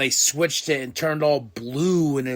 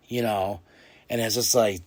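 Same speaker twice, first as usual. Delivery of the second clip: ordinary talk, nothing marked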